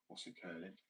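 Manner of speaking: soft attempt at Welsh; tentative put-on Welsh accent. Shown as a demonstration. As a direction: try out a Welsh lilt. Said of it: "So, as for Wales".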